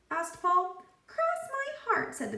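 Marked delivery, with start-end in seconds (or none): 1.1-2.1 high, squeaky talking pigtail. A narrator's reading. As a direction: play the cheeky pigtail, way up high.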